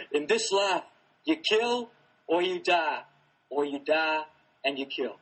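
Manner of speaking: loud, slow put-on character accent; the Governor. Amateur demonstrating an impression to the actor, slow loud accent. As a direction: lay down the law slowly, in character. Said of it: "I can do a pretty good Governor accent".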